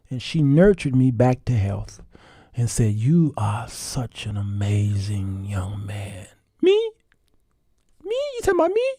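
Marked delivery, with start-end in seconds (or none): 2.9-6.3 slow, soft whispered praise; tender whisper. Retold or imitated. Wife nurturing her husband, slow tender whisper. 6.6-8.9 put-on squeaky, much higher little-boy voice; be a shy kid who can't believe it; childlike disbelief. Husband as a little boy doubting his wife's praise, squeaky and high.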